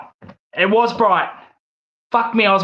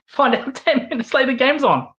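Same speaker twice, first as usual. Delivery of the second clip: laughing in disbelief; 7 semitones higher than usual. Real, not acted.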